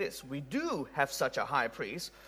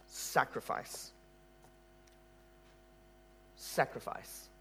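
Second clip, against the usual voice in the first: slow, breathy whispered word; solemn whisper; 3 semitones lower, 11 dB quieter, slower than usual. Acted. Preacher sums up the sanctuary in one word, whispered. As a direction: let the word land in a hush.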